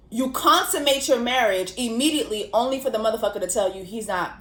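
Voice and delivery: ordinary talk, nothing marked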